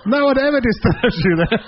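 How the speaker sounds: loud words through laughter; laughing while talking. Real, not acted. Monk delivers the punchline while laughing loudly. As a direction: push the line out while laughing.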